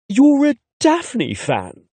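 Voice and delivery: high, slow disbelieving question; can't believe he likes it; incredulous. A narrator's reading. Narrator asks the naked guy, incredulous. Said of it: "I ask incredulously".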